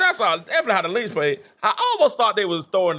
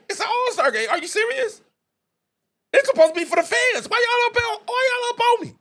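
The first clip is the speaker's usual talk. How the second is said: high whiny mock complaint, much higher pitched; imitating Ari complaining; 13 semitones higher than usual. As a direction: pitch it way up like a player whining to the ref. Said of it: "and she like".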